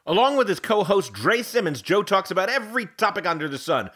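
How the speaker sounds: booming announcer ad read; loud promo read. Acted. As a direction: sell it loud like a radio promo.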